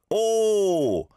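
"oh" with a full oh vowel, not ah; high, loud, drawn-out "oh"; rounded oh sound. Shown as a demonstration. Host corrects a caller's vowel, rounded oh sound on "oh". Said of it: "as in an oh sound rather than an ah sound".

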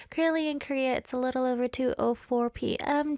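ordinary talk

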